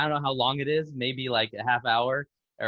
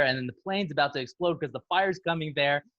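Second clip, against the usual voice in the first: ordinary talk, nothing marked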